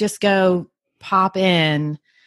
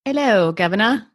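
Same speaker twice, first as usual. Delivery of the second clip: amateur British accent; not-so-great mock British accent. Shown as a demonstration. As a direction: attempt a playful British greeting. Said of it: "my British accent is not all that awesome".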